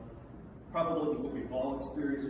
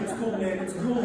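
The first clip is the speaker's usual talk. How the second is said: begging; 8 semitones higher, 4 dB louder than usual. Retold or imitated. Beaten guy begging Tommy.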